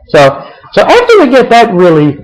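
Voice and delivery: ordinary talk, nothing marked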